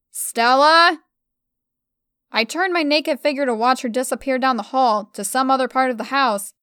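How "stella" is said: loud furious call of a name; angry yell. A narrator's reading. Emma yells after Stella angrily. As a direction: yell her name in rage. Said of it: "I roared at her".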